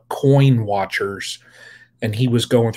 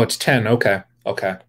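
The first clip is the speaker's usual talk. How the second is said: usual speaking voice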